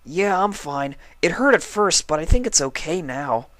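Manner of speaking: high, eager little dragon voice; young dragon Spike. Acted. Spike reassures sick Twilight, high young dragon voice.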